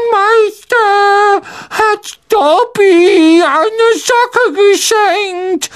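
very high, slow elf voice; verwunderter Hauself. A narrator's reading. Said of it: "sagte der Elf verwundert". (sehr hohe, gedehnte, staunende Elfenstimme)

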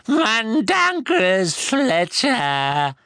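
very slow, high croaking elf voice; a distraught elf naming the thief; croaked. A narrator's reading. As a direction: drag the name out like it hurts. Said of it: "krächzte der Elf".

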